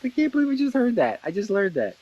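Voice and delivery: high, thrilled disbelief; amazed. Retold or imitated. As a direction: gasp it like news you can't believe. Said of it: "where you're just like".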